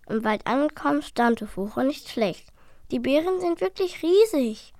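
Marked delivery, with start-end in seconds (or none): 2.9-4.7 high, delighted call; excited. A narrator's reading. Dragon to his brother, excited. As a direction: marvel at the berries. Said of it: "rief er begeistert".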